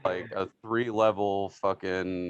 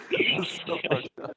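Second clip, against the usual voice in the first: usual speaking voice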